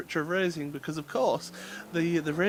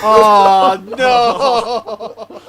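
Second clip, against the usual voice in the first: laughing groan; 4 semitones higher, 15 dB louder than usual. Real, not acted. Groan at a terrible joke.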